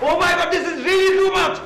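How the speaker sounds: high, worked-up ranting; outraged outburst. Acted. Insulted man threatens to fetch uncle, high and outraged. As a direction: explode with indignation, pitch way up.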